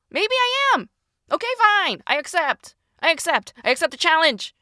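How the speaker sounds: very high-pitched, rising to loud defiance; worked-up acceptance. Acted. Writer answering her own doubts, worked-up acceptance. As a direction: take up the challenge in a high, insistent voice. Acted acceptance of challenge to herself.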